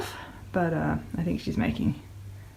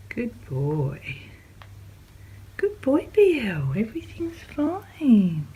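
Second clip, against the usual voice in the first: slow, high whispered reassurance; soothing whisper; 5 semitones higher, slower than usual. Real, not acted. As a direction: whisper it gently to calm them. Soothing animals.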